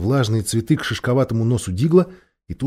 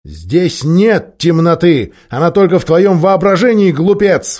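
loud, raised-pitch impatient cry; 5 semitones higher, 5 dB louder than usual. A narrator's reading. King to a dwarf who insists it is dark, crying out. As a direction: shout it at the stubborn fool. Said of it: "вскричал Тириан".

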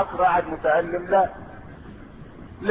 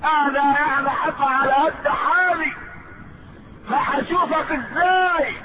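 high, slow, stretched-out lament; acted anguished plea; 5 semitones higher, slower than usual. Companion fearing separation from the Prophet, anguished plea. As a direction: plead high, drawing the words out.

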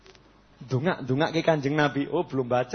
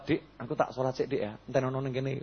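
softer, easy coaxing to a younger brother; relaxed gentle invite; 4 semitones lower, 11 dB quieter than usual. Retold or imitated. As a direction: coax him along kindly. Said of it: "nyampaikannya nyantai". (ajakan lembut dan santai)

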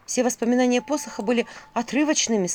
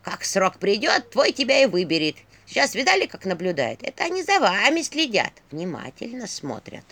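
ворчливая старуха. A narrator's reading. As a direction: grumble like a stern old crone. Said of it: "ворчала Егиня". (ворчливый голос старухи)

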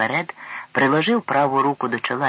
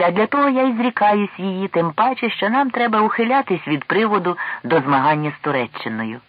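raised, louder, jovial reply; lightly acted good cheer, pitch up; merry; 6 semitones higher, 5 dB louder than usual. A narrator's reading. King gives up the go-between role, merrily. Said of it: "весело засміявся король".

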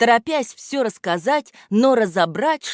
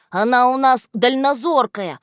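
usual speaking voice

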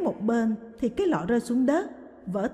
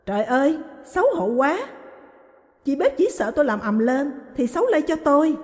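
angry accusation; 4 semitones higher, 3 dB louder than usual. Acted. Wife confronting lying husband.

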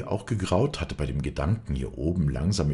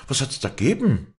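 anxious question; 10 semitones higher, 5 dB louder than usual. A narrator's reading. Walli pressing for details.

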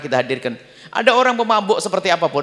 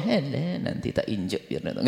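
muttered aside; 6 semitones lower, 11 dB quieter than usual. Retold or imitated.

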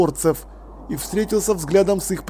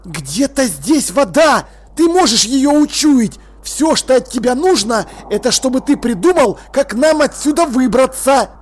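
fierce urging; 7 semitones higher, 5 dB louder than usual. A narrator's reading. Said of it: "прошипел он".

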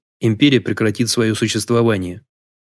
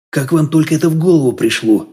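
higher-pitched, impressed question; amazed respect; 5 semitones higher, 3 dB louder than usual. A narrator's reading. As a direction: ask with genuine, astonished respect. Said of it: "В голосе гостя слышалось неподдельное уважение". (изумление с неподдельным уважением)

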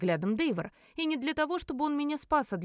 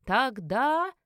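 loud, very slow call from the crowd; loud drawn-out bidder's cry; exclaimed counter-bid; 3 semitones higher, 5 dB louder, much slower than usual. A narrator's reading. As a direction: call out the higher bid, stretched. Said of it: "Раздался новый возглас из толпы".

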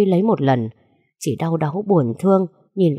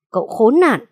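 Cậu khốn nạn shouted out, higher and louder; furious shout; 6 semitones higher, 5 dB louder than usual. Acted. Father yells at his daughter's ex-husband, furious. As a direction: let Cậu khốn nạn burst out in a yell. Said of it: "gằn giọng đầy phẫn nộ".

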